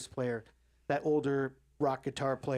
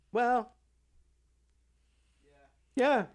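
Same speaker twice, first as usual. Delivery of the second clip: high, louder, drawn mock admission; guitarist's sheepish agreement; 8 semitones higher, 5 dB louder than usual. The old guitarist sides with Nick, high sheepish mock voice. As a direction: admit it in a squeaky high voice. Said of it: "and he's like".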